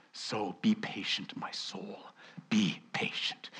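slow, whispered plea to be patient; gentle self-urging. Acted. Preacher quietly urging his own soul to wait. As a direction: soothe your own soul, slow and hushed.

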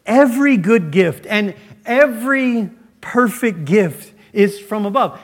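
"every" pitched high and loud each time; preacher driving home "every" in the verse; stress on every. Shown as a demonstration. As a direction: hammer the word "every". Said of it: "Notice James' emphasis on the word every".

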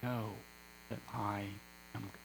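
low, quiet, solemn statement; Jesus. Acted. Jesus in effect to his awed disciples, quiet solemn authority. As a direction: say it softly, with pauses between the words. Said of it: "Jesus was, in effect, saying".

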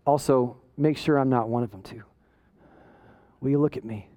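low, soft self-searching plea; low, subdued appeal to God; humble prayer. Acted. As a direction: ask God quietly, with pauses. Humble prayer after mock rant.

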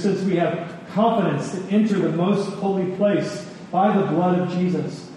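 ordinary talk, nothing marked

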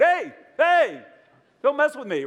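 high, loud mock-touchy warning; obsessive male model. Mocking preacher plays Jesus as a vain male model, hammed-up. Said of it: "obsessive male model".

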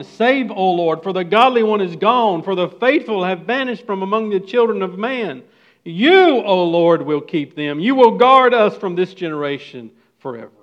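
loud, impassioned reading of the psalm; fervent scripture reading. Acted. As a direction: proclaim the verse with force. Reads scripture aloud.

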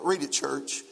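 ordinary talk

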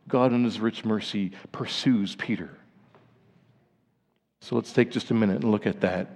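normal talking voice

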